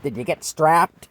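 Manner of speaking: gleeful teacher asking if he got strapped; excited. Retold or imitated. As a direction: ask it gleefully, hoping for bad news. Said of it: "And she's all excited".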